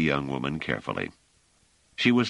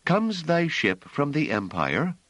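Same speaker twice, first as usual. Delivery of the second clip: put-on higher, slow, clear young woman's voice; clear soprano; 11 semitones higher, slower than usual. A narrator's reading. Hiroko answering the strangers, clear high soprano. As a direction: sing it out lightly like a soprano.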